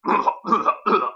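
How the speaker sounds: exaggerated repeated throat clear; awkward throat-clearing. A narrator's reading. Dave stalls with all eyes on him, awkward throat-clearing. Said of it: "He clears his throat awkwardly".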